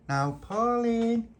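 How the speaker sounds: high, slow sing-song call through the door. Acted. Kid calling at Pauline's door, high drawn-out call. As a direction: draw it out, calling her name.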